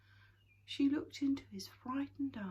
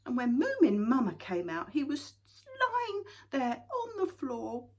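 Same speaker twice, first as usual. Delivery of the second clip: ordinary delivery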